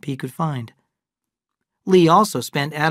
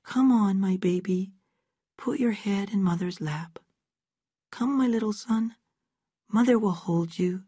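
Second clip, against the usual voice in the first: higher and softer, cooing like a mother; soft motherly tone; 7 semitones higher, 4 dB quieter than usual. Acted. Abra playing mother to Aaron, soft cooing tone. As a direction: hush a little son in your lap. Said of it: "She put a soft tone in her voice".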